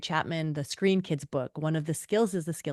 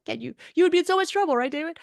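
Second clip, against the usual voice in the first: mock outrage; 10 semitones higher, 4 dB louder, much faster than usual. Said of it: "I'm just mad".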